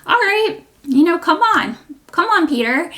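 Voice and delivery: high, loud, teasing Jesus voice; Jesus cheerfully daring Peter to step out; playful Jesus. Retold or imitated. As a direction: voice Jesus as a good-natured dare. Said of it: "And Jesus is like".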